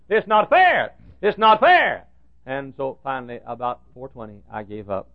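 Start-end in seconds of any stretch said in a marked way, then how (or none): 0.1-2.0 higher, louder retold protest; mad complaint. Secretary insisting to the pastor, raised indignant voice. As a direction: repeat it like a stubborn grievance. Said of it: "She got mad at God".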